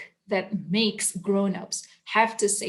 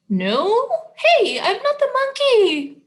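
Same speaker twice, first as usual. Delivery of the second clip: high, laughing protest; mock protest; 13 semitones higher, 4 dB louder than usual. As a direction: squeal out the denial. Protesting the book's words.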